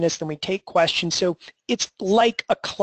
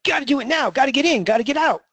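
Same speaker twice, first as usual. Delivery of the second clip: high-pitched, hammed-up trader panic; mock urgency; 8 semitones higher, faster than usual. Trader denying he works in a rush, mock urgency. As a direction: play at frantic urgency, pitched well up.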